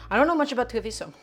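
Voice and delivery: usual speaking voice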